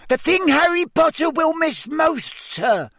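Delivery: very high, squeaky elf voice; squeaky house-elf. Acted. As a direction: squeak it like a tiny anxious elf. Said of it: "squeaked Dobby".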